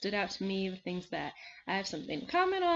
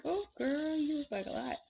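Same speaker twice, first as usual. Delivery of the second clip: high-pitched, sassy acted reaction; mock put-off; 7 semitones higher than usual. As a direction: say it high, as playful judgement. Acted reaction to an overbearing woman.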